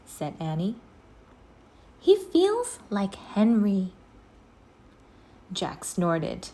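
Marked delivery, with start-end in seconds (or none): 2.0-3.9 Annie's voice. A narrator's reading.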